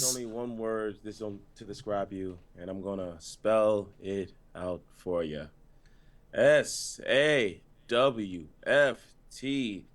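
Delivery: low, slow monotone promo; deep deadpan line reading, spelling letter by slow letter; emotionless wrestler impression. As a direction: drain the emotion, drop deep and drag it out. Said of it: "more emotion than him already".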